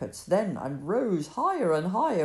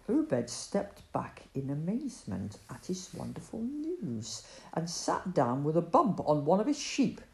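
plain reading voice

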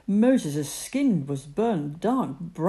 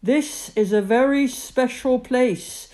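loud, higher, solemn divine voice; booming voice of God; 7 semitones higher, 7 dB louder than usual. Acted. God speaking to Moses from the bush, loud and solemn.

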